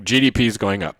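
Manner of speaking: ordinary delivery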